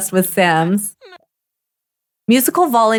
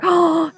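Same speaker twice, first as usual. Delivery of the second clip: delighted gasp; 8 semitones higher than usual. Real, not acted. Player watching Eric read to baby, gasping. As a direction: gasp at something adorable.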